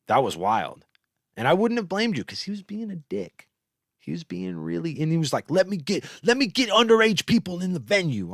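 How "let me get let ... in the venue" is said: high-pitched mock pleading; mocking imitation. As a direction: imitate a petulant demand, pitched way up. Mocks a man's demand in retelling.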